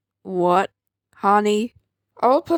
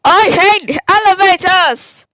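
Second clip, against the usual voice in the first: loud; 5 semitones higher, 8 dB louder than usual. Acted. Loud declaration.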